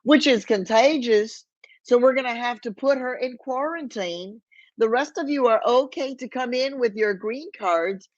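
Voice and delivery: an immigration agent in a hammed-up Texas accent; thick Texas accent. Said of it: "he said in a thick Texas accent".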